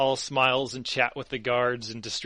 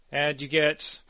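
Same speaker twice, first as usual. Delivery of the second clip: ordinary talk, nothing marked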